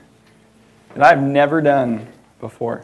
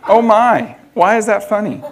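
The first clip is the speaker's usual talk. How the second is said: high, louder mock-alarmed mother; shocked mom; 6 semitones higher, 4 dB louder than usual. Voicing the mom who misread LOL, mock alarmed. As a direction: play the baffled mom, a bit higher.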